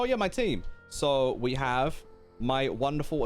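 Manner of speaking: ordinary talk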